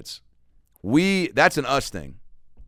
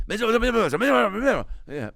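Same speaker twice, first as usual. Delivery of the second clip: high-pitched garbled jabber; mock excited cops jabbering over each other; mimicked cop clamour; 12 semitones higher than usual. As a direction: squeal out mush like frantic cops. Mimics the slow cops' garbled clamour.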